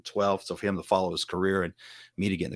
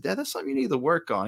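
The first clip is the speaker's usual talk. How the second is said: lightly imitated son, pitched higher; son's voice; 8 semitones higher, faster than usual. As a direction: quote your son in a raised voice. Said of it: "he said".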